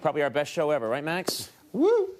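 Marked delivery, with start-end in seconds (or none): 1.7-2.1 high, loud "woo"; excited whoop. Real, not acted. Answering the host about the best show ever, a loud whoop. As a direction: let out a short, high cheer. Whoop after host's question.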